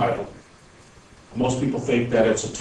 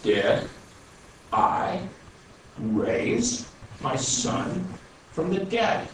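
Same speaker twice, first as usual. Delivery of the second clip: each word spaced out, very slow; very slowly; 6 semitones higher, 3 dB quieter, much slower than usual. Retold or imitated. The Lord answers Gary firmly, very slow and spaced out. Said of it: "I'm gonna say this real slowly".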